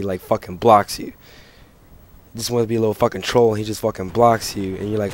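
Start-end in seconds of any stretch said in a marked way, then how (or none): none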